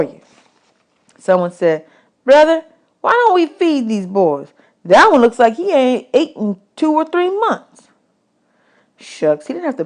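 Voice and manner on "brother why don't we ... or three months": lightly voiced camp man, higher; a hint of a kindly drifter; hobo man voice. Man at the camp urging others to feed the boys, lightly voiced.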